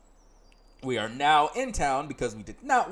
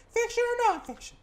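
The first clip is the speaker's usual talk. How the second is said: put-on bright girl guide voice, much higher; high companion voice; 3 dB louder than usual. Companion greeting the player in town, high voice. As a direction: play the chirpy companion up high.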